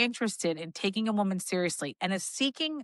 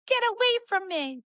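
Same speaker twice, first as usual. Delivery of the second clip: put-on much higher snippy teen girl; mock girl brushing off; 12 semitones higher than usual. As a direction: squeak it like a girl shooing off a shy boy. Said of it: "she's like".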